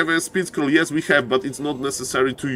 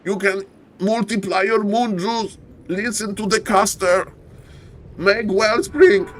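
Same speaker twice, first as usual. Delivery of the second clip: put-on old man yelling; 7 semitones higher than usual. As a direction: rant like a grumpy old man at the sky. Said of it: "I'm just yelling at Wellspring".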